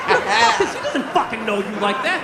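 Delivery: ordinary delivery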